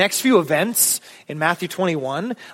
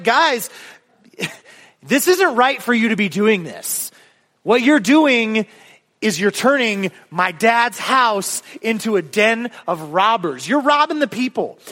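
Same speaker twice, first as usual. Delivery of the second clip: higher, emphatic angry rebuke; Jesus, mad; 5 semitones higher than usual. Acted. Angry Jesus confronting the temple sellers, heated rebuke. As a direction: scold them in exasperation, pitch raised. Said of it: "But he's mad. He's like".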